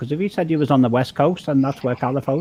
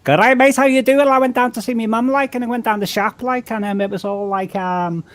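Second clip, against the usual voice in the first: broad, high Scouse voice, hammed up; exaggerated Liverpool accent, much higher; 8 semitones higher than usual. Shown as a demonstration. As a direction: put on a thick Liverpool accent. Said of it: "a real Liverpool accent".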